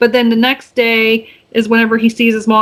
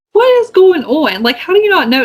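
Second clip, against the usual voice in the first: baffled disbelief; 7 semitones higher than usual. Retold or imitated. Viewer baffled that nobody notices his pointy ears, incredulous. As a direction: throw up your hands in disbelief.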